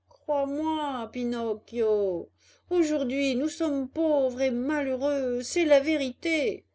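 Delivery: hammed-up misery; plaintive pleading. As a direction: wail it like a wheedling fox. Begging fox pleads with Pinocchio.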